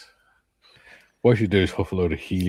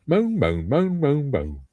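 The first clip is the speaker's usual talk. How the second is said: high, sing-song mock moaning; higher mocking moan; mock whining; 7 semitones higher, slower than usual. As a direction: parrot the whiners mockingly. Mocking the complainers.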